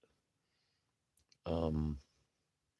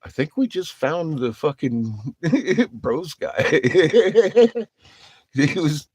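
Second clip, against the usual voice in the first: laughing; 8 semitones higher, 6 dB louder, slower than usual. Retold or imitated.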